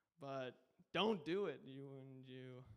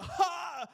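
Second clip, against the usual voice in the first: a single sharp high "Ha!"; short mock laugh burst; imitated bark laugh; 11 semitones higher, 9 dB louder than usual. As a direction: bark one loud laugh. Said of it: "his laugh is like that".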